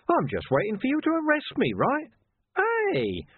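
high, cheeky taunting voice; taunting villain. A narrator's reading. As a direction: goad him with a grin.

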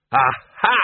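loud exclamation; Arch-Chancellor unveiling his cure; triumphant aha. Acted. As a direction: brandish it like a trophy. Arch-Chancellor brandishes object triumphantly.

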